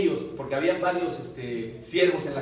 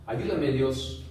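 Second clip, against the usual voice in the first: closing prayer; 7 semitones lower, 4 dB quieter than usual. Acted. Preacher leads the congregation in prayer: soft, humble, low. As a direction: pray humbly, barely above a murmur.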